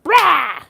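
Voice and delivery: high, loud imitated burst of sound; sudden loud "brah" for a wild leap; mock flinging noise. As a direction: throw out a high, exaggerated cry. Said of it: "it's not like they went".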